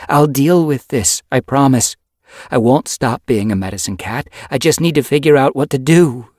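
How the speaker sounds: loud, high-pitched pleading insistence; insistent. A narrator's reading. Yellowfang promising to stay a medicine cat, insistent. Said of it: "Yellowfang insisted".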